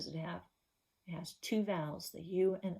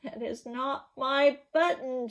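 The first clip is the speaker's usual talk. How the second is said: higher, louder, slow shouted denial; 6 semitones higher, 6 dB louder than usual. Acted. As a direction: yell it out, fed up. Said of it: "shouted Toad".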